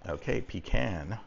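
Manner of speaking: ordinary delivery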